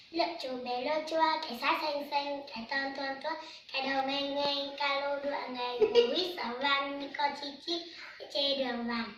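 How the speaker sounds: soft, sing-song recited verse; poem recitation. Acted. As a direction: chant the poem line by line like a schoolchild. Child recites poem for adult. (đọc thơ nhẹ nhàng, ngân nga như học sinh)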